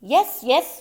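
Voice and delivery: ordinary delivery, nothing marked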